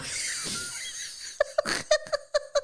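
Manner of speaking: high, wheezing laugh; helpless laughter. Real, not acted. Co-host tickled by a wrong actor guess, helpless laughter. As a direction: crack up, squeaking breathlessly.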